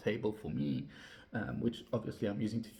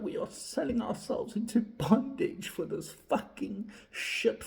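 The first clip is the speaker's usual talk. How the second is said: ordinary delivery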